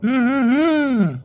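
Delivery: high, drawn-out teasing hum; a long, sing-song mocking hmm. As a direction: stretch the hums slowly to mock him.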